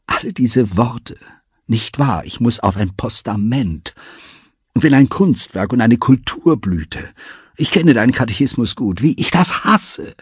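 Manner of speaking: high, loud, scornful outburst; hasserfüllter Ausbruch. A narrator's reading. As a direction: build to a high, loud cry of hatred. Elita's tearful outburst of hate. (empört, hoch und laut steigernd)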